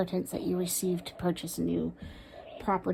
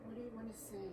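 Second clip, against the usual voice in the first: much softer murmur of the question; very soft searching murmur; hushed; 3 semitones higher, 20 dB quieter, much faster than usual. Real, not acted. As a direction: ask it under your breath. Asking the spirit again.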